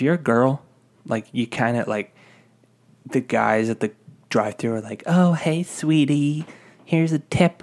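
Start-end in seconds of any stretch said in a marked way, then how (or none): 5.1-7.6 higher, sing-song mock flirting; flirty customer.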